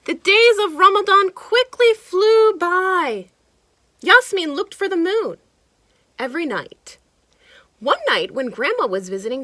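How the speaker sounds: lively storybook narration; animated read-aloud for kids; reading to children. A narrator's reading. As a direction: read it like a picture book to a child. Reading picture book aloud.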